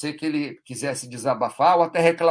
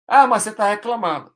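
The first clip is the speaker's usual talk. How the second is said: mock accusing retort; 7 semitones higher, 7 dB louder than usual.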